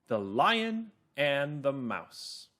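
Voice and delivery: slow, measured storytelling, word by word; calm, clear narrator. Shown as a demonstration. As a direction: read it slowly and clearly for children. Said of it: "my narrator voice is calm and clear".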